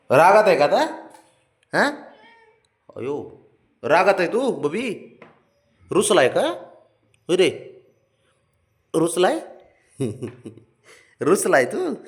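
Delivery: put-on high sing-song coaxing to a toddler; baby talk. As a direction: coo and tease a sulking little boy. Teasing sulking child.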